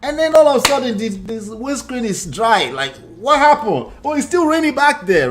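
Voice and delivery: high, loud, amazed storytelling; acted astonishment. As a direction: burst out in sudden bewilderment. Acts out sudden surprise while driving.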